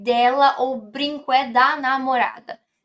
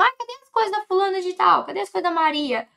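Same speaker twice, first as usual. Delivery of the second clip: mock fussy question; 7 semitones higher, much faster than usual. Acted question looking for belongings.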